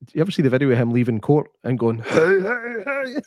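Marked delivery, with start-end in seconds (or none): 2.0-3.2 high mock Fat Albert "hey, hey, hey"; sing-song cartoon greeting, pitched up; Fat Albert impression. Mimicking Cosby leaving court in Fat Albert voice. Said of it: "He did the Fat Albert".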